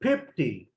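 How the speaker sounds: "फिफ्टी" with P sounds replacing both Fs, like "pipti"; Bengali P for F. Shown as a demonstration. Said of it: "Bengali speaker की मिसाल दे देता हूँ". ("फिफ्टी" को बंगाली लहजे में "पिप्टी" बोलें)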